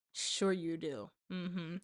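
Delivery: quiet, dry sarcasm; a sceptical, unimpressed mm-hmm; sarcastic. Real, not acted. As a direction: drop it low and doubting. Sarcastic dismissal of doubters.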